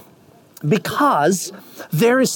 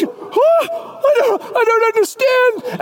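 fan baffled by the invitation; bewildered; 13 semitones higher, slower than usual. Acted. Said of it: "You're like".

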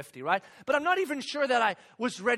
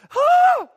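high, loud overwhelmed "aah"; sustained high exasperated shout; exasperated cry; 14 dB louder than usual. Acted. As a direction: let out a sudden performed yell of overwhelm. Overwhelmed by complex questions.

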